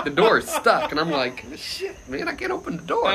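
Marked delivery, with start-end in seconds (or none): 1.6-3.0 high-pitched retold dismay; squeaky mock distress; mock panic. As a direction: act out realising you're stuck, voice jumping up. Acts out discovering stuck door.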